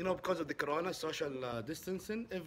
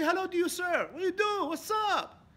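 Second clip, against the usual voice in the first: much higher, louder bright hello; cheery greeting demo; 7 dB louder than usual. Shown as a demonstration. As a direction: show how to greet back eagerly. Modelling a cheerful reply.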